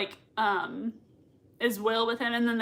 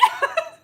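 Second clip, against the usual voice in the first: laugh. Real, not acted. Fan laughing.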